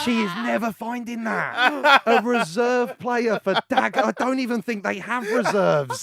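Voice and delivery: high, slow words through laughter; cracking up as you speak; slowed and laughing. Real, not acted. Cracking up at the story.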